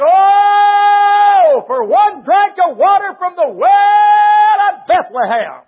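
high, loud and drawn-out cry for water; David's longing cry. Acted. David crying out from the hold for water, loud and longing. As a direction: cry out from the hold, aching for a drink.

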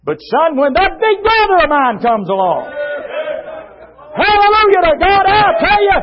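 high, loud revivalist shouting; triumphant pulpit climax, shouted; full-force preaching. Real, not acted. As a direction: preach it at the top of your voice.

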